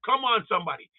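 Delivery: ordinary talk, nothing marked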